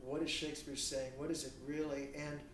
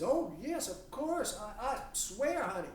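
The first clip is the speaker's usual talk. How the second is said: much higher, overeager sweet voice of a lover swearing devotion; gushing lover; 10 semitones higher, 4 dB louder than usual. Pretend. Honey Bunny swears undying love to their partner, high gushing voice. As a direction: lay it on thick, squeaky and too eager to convince. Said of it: "And Honey Bunny says".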